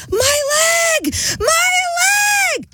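high-pitched, drawn-out mock scream; screaming at the top of the lungs; imitated scream. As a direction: wail it out like a girl screaming her lungs out. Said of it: "screaming at the top of her lungs".

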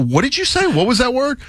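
high-pitched puzzled questions; confused listener. Acted. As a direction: play someone who can't make out the slurred word.

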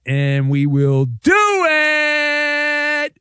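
high, slow drawn-out announcer shout; announcer tease. Acted. Host teasing the feud before the break, slow big shout. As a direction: stretch it out big like a showman.

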